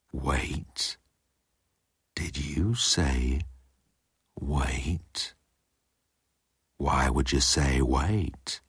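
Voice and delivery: low, soft, slow questioning; menacing disbelief. A narrator's reading. Jeltz questions his crewman's outburst, low and menacing. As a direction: pick it apart slowly, softly.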